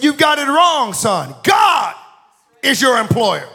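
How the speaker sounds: high, shouted preacher's rebuke; re-enacted booming declaration; forceful correction. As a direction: thunder the correction at him. Imitating preacher's loud correction.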